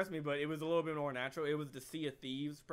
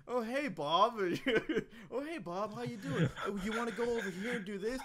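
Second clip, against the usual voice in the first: fake cheerful; 7 semitones higher, 4 dB louder than usual. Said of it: "and she goes".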